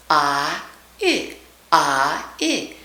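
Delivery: "Ah" alone, then "I" closed up into a full glide; lazy Texan vowel set against the full diphthong; diphthong demo. Shown as a demonstration. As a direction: contrast the flat "ah" with the gliding "I". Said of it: "I is actually another diphthong".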